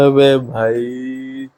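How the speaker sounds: very slow, stretched-out incredulity; dumbfounded, lingering reaction; drawn-out disbelief. Real, not acted. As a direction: drag out each word in disbelief. Stunned reaction to the played clip.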